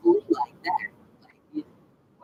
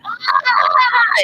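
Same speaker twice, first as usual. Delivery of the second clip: retold pained yelp; 7 semitones higher, 6 dB louder than usual. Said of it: "I was like".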